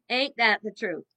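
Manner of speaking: usual speaking voice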